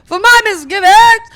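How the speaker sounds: high, loud hammed-up begging through laughter; mock pleading apology. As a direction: beg forgiveness in mock desperation. Mock pleading apology to bumped crowd.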